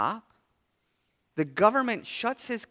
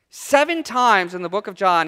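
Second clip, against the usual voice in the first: normal talking voice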